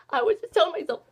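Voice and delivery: normal talking voice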